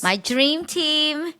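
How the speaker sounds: high, slow sing-song. Pretend. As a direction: croon it like a fond chant. Reminiscing about the cast.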